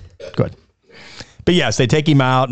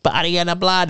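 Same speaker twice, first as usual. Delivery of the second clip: lightly put-on creepy girl voice; changing possessed voice; 3 semitones higher, 3 dB quieter than usual. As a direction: imitate a possessed girl's voice starting to change. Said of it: "and her voice starts to change".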